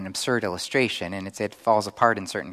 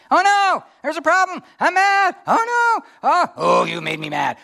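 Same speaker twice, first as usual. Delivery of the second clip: high, loud squealing panic voice; cartoonish alarm, shrieking high; mock panicked emotional brain; 7 dB louder than usual. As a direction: play the frantic amygdala, hammed up. Preacher acts out angry emotional brain.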